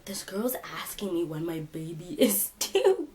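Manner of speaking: hushed, breathy realization; whisper. Real, not acted. Realizing the woman means a baby, a shocked whisper. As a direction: whisper it like a horrified secret.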